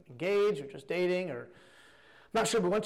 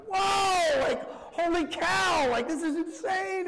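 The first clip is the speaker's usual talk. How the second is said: shocked exclamation; 11 semitones higher, much slower than usual. Retold or imitated. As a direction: gasp it out as if the twist just hit you. Remembered shock at plot twist.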